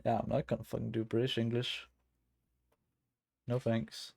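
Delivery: ordinary delivery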